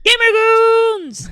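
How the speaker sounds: drawn-out hype shout; rallying shout. Real, not acted. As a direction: yell it like a rally chant. Rallying cry after "unite.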